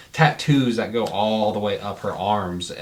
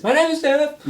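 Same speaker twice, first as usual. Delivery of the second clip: high, goofy far-off holler; distant shout; 4 dB louder than usual. Retold or imitated. Game master echoes Jeff heard in the distance, mock holler. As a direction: mimic a shout from down the hall. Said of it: "you hear out the distance".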